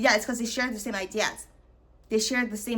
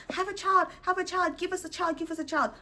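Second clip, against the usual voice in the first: high, whiny mock begging; mocking pleading parents; 9 semitones higher than usual. Mocking relatives who beg for a baby, whiny. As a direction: mimic nagging relatives begging for a grandchild. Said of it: "these same people who are like".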